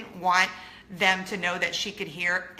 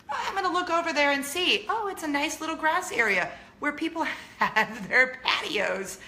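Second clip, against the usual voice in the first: mock naive thinking; 7 semitones higher than usual. Mocking the daughter's thinking.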